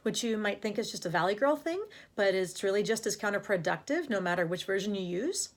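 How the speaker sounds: put-on Valley Girl upspeak, "thing" and "use" rising; questioning rise on "thing" and "use". Shown as a demonstration. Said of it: "a pattern that's called upspeak".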